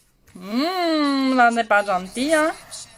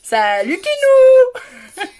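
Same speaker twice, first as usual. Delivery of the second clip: long, high, loud wail of Salut Kinou; wailing greeting; 14 semitones higher, 12 dB louder, much slower than usual. Real, not acted. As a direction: stretch the greeting into a crying howl. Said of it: "ils doivent se dire, ça y est, elle a craqué".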